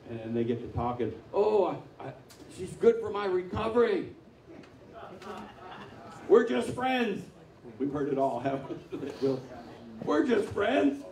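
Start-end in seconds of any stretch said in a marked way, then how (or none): 1.3-7.2 high, pleading mock voice of a smitten guy; mock lovesick excuse. Preacher mocking a smitten guy's excuse, high and pleading. 10.0-11.0 high, slow, drawn-out mock excuse; slow mocking repeat. Preacher mocking the excuse again, high and slow.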